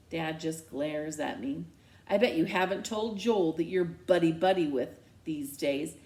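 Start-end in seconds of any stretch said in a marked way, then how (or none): none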